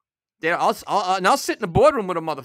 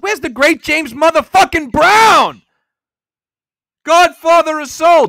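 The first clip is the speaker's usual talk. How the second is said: loud, higher, indignant proclamation; outraged shout; 7 semitones higher, 11 dB louder than usual. Real, not acted. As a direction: shout his name like a hype man. Outraged about [name] missing.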